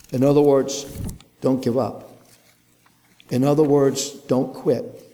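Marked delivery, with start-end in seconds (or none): none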